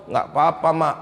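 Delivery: put-on low, soft child's denial; meek little answer to mother; sulky tearful child. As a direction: mumble it like a kid hiding tears. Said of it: "Pulang nangis, mata merah".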